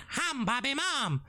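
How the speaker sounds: high imitated catchphrase call; comedian's catchphrase. Host imitating a comedian's podcast bit, high mimicry. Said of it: "When he does a thing with his mate".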